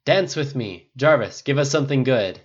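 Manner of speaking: loud, high party demand; calling out. A narrator's reading. Pepper pulling Tony up to dance, calling out. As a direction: call it eagerly over the party. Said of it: "Pepper called".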